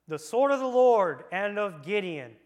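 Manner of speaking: loud proclaimed war cry; battle cry. A narrator's reading. Preacher crying out the soldiers' battle shout loudly. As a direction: call it out like soldiers charging.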